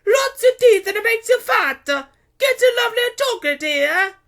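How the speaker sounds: very high, booming market call; hawker's cry. A narrator's reading. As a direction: shout your wares across the street. Vendor calls out from stall.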